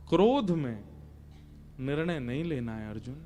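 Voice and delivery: low, softened, measured advice; calm counsel. Acted. God advising a confused Arjun, low and calm. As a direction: drop down low and quiet to counsel.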